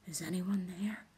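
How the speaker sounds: hushed, unvoiced half whisper; whispered question to an empty room. A narrator's reading. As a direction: breathe it into the dark. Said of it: "he said in a half whisper".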